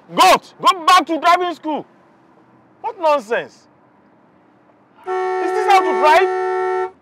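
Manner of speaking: loud, high-pitched scolding; angry road rage. Acted. Driver berating another motorist on the road, high angry shouting. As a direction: yell insults out at a bad driver.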